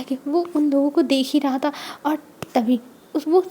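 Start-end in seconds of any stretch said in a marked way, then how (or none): none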